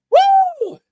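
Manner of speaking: high, loud "woo" cry; exultant whoop. Real, not acted. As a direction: let out a high preacher's whoop. Preacher whoops mid-sermon.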